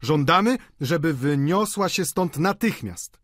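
high, measured crowd demand; shouted demand. A narrator's reading. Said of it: "zawołali wszyscy stołownicy".

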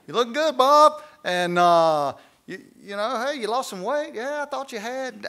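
high, sweet flirting coworker voice; flirty woman. Retold or imitated. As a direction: coo it like a woman flirting at work. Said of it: "this woman says".

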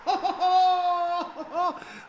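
high, joyful burst of laughter; delighted laugh. Real, not acted. Celebrating a goal.

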